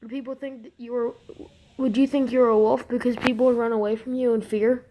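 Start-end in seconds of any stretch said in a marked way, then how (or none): none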